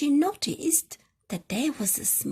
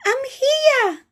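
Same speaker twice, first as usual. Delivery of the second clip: very high, loud little rabbit voice; tiny rabbit calling out high; 9 dB louder than usual. Acted. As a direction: squeak it out high and bright. Little rabbit announces herself.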